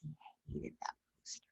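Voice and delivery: conspiratorial whisper; whispered aside. Real, not acted. Host whispers a dig about her father's poster. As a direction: drop to a secretive whisper.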